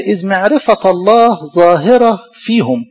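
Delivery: solemn verse recitation. Acted.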